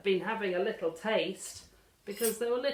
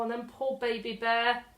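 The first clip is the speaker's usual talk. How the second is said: ordinary delivery, nothing marked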